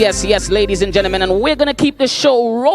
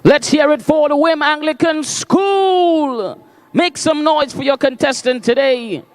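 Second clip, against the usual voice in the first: booming pumped announcer; 4 semitones higher than usual. Real, not acted. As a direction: get the crowd cheering. MC hypes the crowd.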